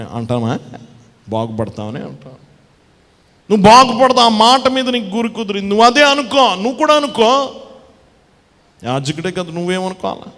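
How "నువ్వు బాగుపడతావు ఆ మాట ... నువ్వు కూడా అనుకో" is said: loud, ringing preacher's call, slowing on the last words; preaching at full force. Acted. Preacher calling out loudly to the congregation about the sick woman.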